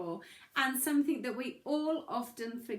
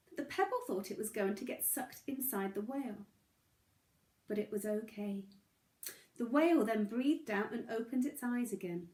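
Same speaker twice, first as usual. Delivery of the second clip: plain reading voice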